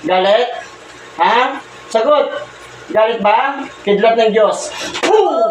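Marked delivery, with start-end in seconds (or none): 5.0-5.4 a sharp puff of breath blown out; blowing puff. Real, not acted. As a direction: blow a quick burst of air as if casting out a spirit. Healer blowing on the patient.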